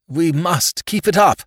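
breathless, high and loud; panting. A narrator's reading. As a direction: pant out between breaths. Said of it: "panted Whimsy".

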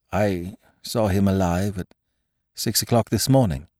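plain reading voice

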